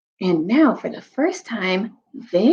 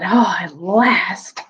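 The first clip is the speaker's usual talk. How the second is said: slow, breathy whisper of relief; relieved whisper; much slower than usual. A narrator's reading. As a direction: sigh it out slowly like a long wait ended. Badgers relieved at king's return.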